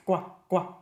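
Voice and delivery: hard G duck quacks at the back of the throat; French duck quack. Shown as a demonstration. Singing teacher demonstrates a French duck quack. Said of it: "just going quack".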